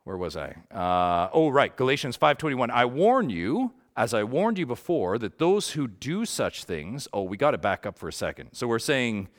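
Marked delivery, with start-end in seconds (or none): 2.7-7.1 scripture reading. Acted.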